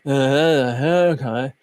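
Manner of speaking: slow, low drone imitating a student; monotone student impression. Coach showing how his student talks, slow monotone imitation. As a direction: drag it out in a mock monotone. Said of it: "That's exactly how he talks".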